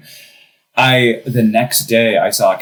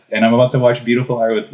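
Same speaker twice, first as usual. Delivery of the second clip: usual speaking voice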